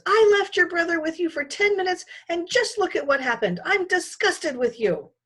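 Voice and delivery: high, loud scolding; mother telling off her son; angry mother. Acted. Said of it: "My mother raised her voice".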